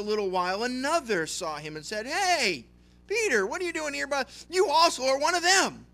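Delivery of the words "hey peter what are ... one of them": high, loud call-out; a bystander shouting at Peter that he's one of them; loud accusation. Acted. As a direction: catch him out across the courtyard. Another accuser calls out Peter.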